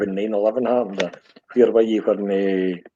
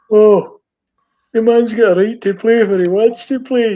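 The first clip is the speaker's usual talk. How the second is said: much higher and louder sneering parody of his words; mocking echo; 11 semitones higher, 9 dB louder than usual. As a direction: throw his own line back at him, jeering. Brother throws his words back.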